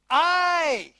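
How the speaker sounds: "I" punched loud; stress on I. Shown as a demonstration. Preacher stressing "I" for the congregation. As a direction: lean hard on "I". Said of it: "see the personal pronoun?".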